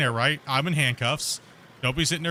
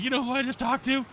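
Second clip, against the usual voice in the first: very high-pitched incredulous mimicry; incredulous supervisor; 12 semitones higher than usual. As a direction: throw the question back in a high mock voice. Supervisor acted throwing it back.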